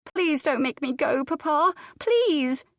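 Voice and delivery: put-on high, desperate child begging; sobbing plea. Girl sobs into her father's shoulder, pleading high. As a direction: beg as if your heart will break. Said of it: "She sobbed into his shoulder".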